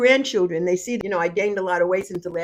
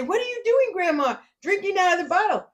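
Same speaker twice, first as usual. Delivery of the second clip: put-on high, fast kid's voice; grandchild voice; 11 semitones higher than usual. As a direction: squeak it like a shocked grandkid. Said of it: "And they look at you and say".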